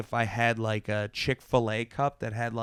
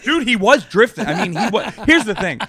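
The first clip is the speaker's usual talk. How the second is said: śmiejąc się; 10 semitones higher, 9 dB louder than usual. Real, not acted. Laughing while telling the story.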